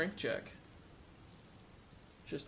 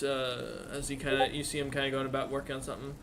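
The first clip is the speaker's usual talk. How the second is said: normal talking voice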